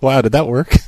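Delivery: ordinary talk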